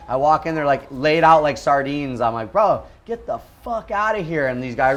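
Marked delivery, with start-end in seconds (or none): 2.5-4.5 high, sharp angry yell; mock furious order to get out; snapping at them. Surfer snapping at squatters to get out. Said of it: "I was just snapping".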